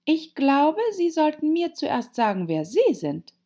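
high, loud, weighty Alice; girl drawing herself up, emphatic; self-important. A narrator's reading. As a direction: declare it with great importance. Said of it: "sagte gewichtig".

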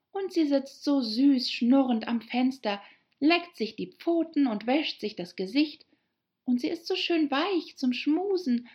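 put-on high-pitched girl gushing about her pet; schwärmende Alice. Alice chatting fondly about her cat. (schwärmerisch plappernde, hohe Mädchenstimme)

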